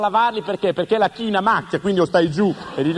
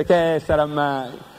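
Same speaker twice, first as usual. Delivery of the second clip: low, slow, lazy sister; 5 semitones lower, much slower than usual. Acted. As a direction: drag it out like a bored sister. Sorella con voce bassa lenta. (sorella svogliata, lenta e bassa)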